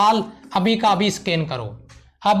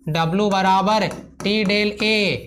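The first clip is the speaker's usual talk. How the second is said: slow dictation; 3 semitones lower, much slower than usual. Shown as a demonstration. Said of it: "याद आया कुछ".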